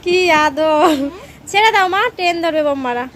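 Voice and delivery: loud high exclaim; excited loud call. Real, not acted. Calling out to daughter hugging.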